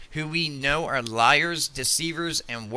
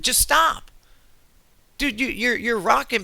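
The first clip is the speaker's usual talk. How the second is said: "Just stop" thrown out much higher, strained and pleading; exasperated stop; 9 semitones higher, 3 dB louder than usual. Real, not acted. Telling Asmongold to quit the doom talk, exasperated cry. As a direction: snap at him to quit it, voice cracking upward. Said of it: "I'm gonna settle down".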